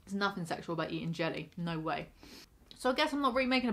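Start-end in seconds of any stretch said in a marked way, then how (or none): 0.0-2.1 speaking around soft food; talking while chewing. Real, not acted. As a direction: mouth partly full of jelly. Seen 2: Spoon just in mouth; grimacing, lips working while talking with food.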